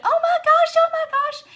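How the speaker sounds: very high, loud fangirl squeal; mimicking a thrilled fan shrieking; excited squeal. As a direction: gush it in a piercing, starstruck pitch. Acts out the fan's thrilled reaction.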